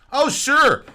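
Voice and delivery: high, loud mock concession; sarcastic agreement. Preacher conceding that stabbing enemies is possible, sarcastic. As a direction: exaggerate the agreement as if granting a silly option.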